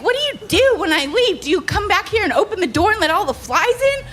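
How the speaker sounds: hammed-up angry roommate; accusing roommate. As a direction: nag like a suspicious landlady. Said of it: "And she's like".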